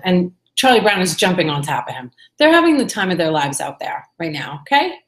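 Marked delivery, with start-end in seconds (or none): none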